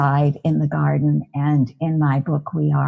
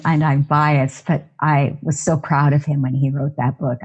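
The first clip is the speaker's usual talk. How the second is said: ordinary delivery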